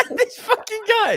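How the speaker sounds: high, drawn-out laughing exclamation; cracking up at a friend. Real, not acted. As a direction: say it through real laughter.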